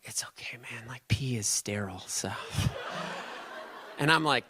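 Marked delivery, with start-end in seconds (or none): 0.0-2.3 soft, breathy aside to a friend; whisper. Retold or imitated. Whispering to co-star on set. 2.4-3.9 soft airy chuckle; breathy laugh. Real, not acted. After the whispered joke.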